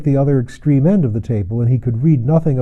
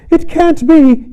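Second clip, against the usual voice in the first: a sudden high scream, much louder, slow; shrill scream; 6 dB louder, slower than usual. A narrator's reading. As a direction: scream it out high like a startled woman. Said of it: "screamed out suddenly like a woman".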